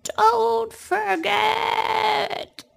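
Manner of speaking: very drawn-out distant shout; a far-off echoing cry from behind; old man's voice echoing after Amanda; echoing call. Acted. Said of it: "the old man's voice echo".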